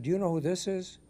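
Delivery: acted operator's probing question; turning the question back. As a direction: ask it back, lifting the pitch without shouting. Operator turns admiral's question back.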